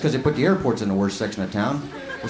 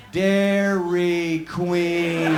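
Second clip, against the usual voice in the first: deadpan slow; much slower than usual. Retold or imitated. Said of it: "she looked at me and said".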